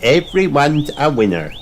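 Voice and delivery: high eager stallholder pig; squeaky piggy sales pitch; Peppa voice. A narrator's reading. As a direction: invite him brightly like Peppa. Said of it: "asks Peppa".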